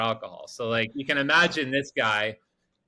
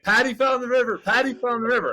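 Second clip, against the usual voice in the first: high, loud mock panicked yell; imitated yelling; 10 semitones higher, 5 dB louder, faster than usual. Crew yelling to the boat driver that Patty fell in, imitated yelling. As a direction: yell it like alarmed bystanders. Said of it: "like, yelling at me".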